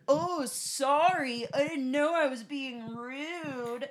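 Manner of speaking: high, slow, sarcastic mock apology; loud mocking man. Mocking a rude bar patron's loud fake apology, high and drawn out. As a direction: ham up a huffy man's fake sorry. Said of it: "He was loudly like".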